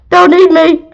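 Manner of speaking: high, loud panicked shout; frightened cry. Acted. Villager scared of the monsters, frightened shout. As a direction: cry out as if about to be eaten.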